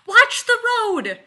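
very high, loud, drawn-out yell; alarmed, full-voice shriek; panicked scream. Acted. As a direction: scream a sudden warning at the driver. Panicked warning breaking off a kiss.

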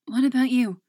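breathless, loud gasp of words; out of breath. Acted. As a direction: push it out short of breath. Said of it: "she gets out, gulping air".

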